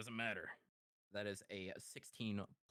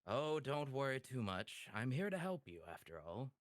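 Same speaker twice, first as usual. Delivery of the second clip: higher, louder reassurance that settles at the end; lightly voiced reassuring Edicts; 6 semitones higher, 5 dB louder than usual. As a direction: lightly play a devil offering help. Voices Edicts reassuring the cornered man.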